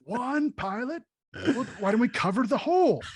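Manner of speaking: high, laughing mock outrage; exasperated Emperor. Emperor scolding Vader about the design flaw, high laughing outrage. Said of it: "It's just like".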